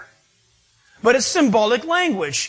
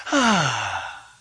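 contented sigh; 8 semitones lower, 3 dB quieter than usual. Pretend. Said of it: "You know, you just kinda".

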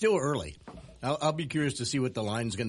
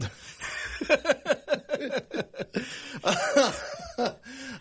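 long high-pitched laughing fit; 14 semitones higher than usual. Real, not acted. Co-host after fooling the host, high laughter. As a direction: crack up at your own joke. Cracks up at own made-up story.

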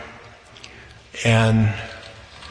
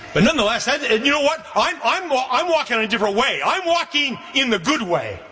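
raised, ringing preacher's voice, pitched well higher; preaching; 9 semitones higher than usual. Acted. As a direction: lift it up and proclaim to the congregation. Preaching burst in testimony.

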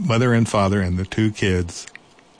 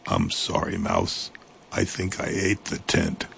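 low, softer guilty monster voice; sheepish monster; 4 semitones lower, 5 dB quieter than usual. Acted. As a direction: rumble a small shamefaced apology. Monster apologizes.

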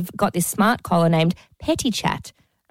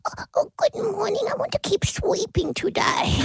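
much higher, softer put-on little dog voice sliding into a whisper; nervous dog voice; 14 semitones higher, 3 dB quieter than usual. Dog greets his owner back, high, soft and timid. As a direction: play a timid little greyhound, tiny and hushed. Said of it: "That's how he talks".

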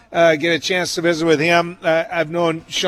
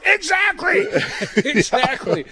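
high, eager exclamation; excited agreement; 11 semitones higher than usual. Retold or imitated. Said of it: "a lot of mayors listening to us right now are going".

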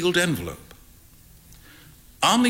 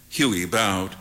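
plain reading voice

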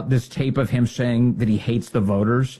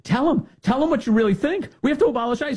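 much higher, pushy cheerleader voice; egging him on, pitched way up; mock eager Jane; 13 semitones higher than usual. Jane urging the candidate to say it, high and pushy. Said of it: "Jane's encouraging him".

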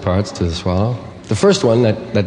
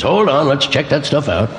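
higher-pitched voice of a vigilant checkpoint; the throat halting things to inspect; throat talking; 5 semitones higher than usual. Acted. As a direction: pipe up like a guard stopping traffic. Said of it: "your throat says".

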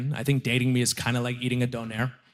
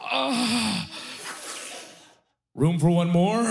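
awkward joke; 6 semitones higher, much slower than usual. Retold or imitated. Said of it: "I was like".

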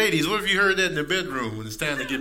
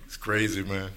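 ordinary talk, nothing marked

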